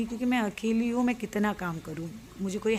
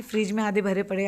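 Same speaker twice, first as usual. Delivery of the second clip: ordinary delivery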